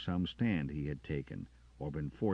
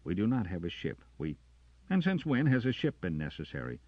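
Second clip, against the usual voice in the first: louder, higher, tense officer pleading; officer pressing the sergeant, louder; raised character voice; 10 semitones higher, 5 dB louder than usual. Acted. Arms officer arguing with sergeant, raised and tense.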